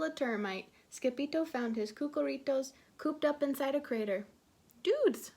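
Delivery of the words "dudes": high excited shout. A narrator's reading. Scipito shouting to his friends. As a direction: call out to the gang, high. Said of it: "shouted Scipito".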